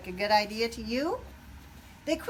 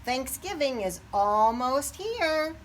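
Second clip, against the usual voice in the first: Farmer Joe; 5 semitones higher, 5 dB louder, slower than usual. A narrator's reading. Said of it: "Farmer Joe continued".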